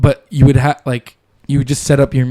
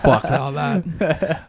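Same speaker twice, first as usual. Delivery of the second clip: slow laughing dismissal; 5 dB quieter, much slower than usual. Retold or imitated. Acted quote, slow laughing.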